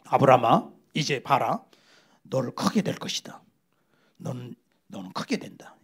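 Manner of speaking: low, hushed voice of God promising; God's voice. Acted. God speaking to Abraham, voiced.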